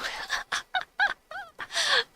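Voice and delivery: a sudden high-pitched laugh; amused high giggle. Real, not acted. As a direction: laugh in disbelief at yourself. Laughs at her own discovery.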